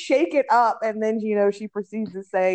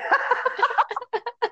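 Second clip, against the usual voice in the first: high laughter; 11 semitones higher than usual. Real, not acted. Laughing at Mumbai memory.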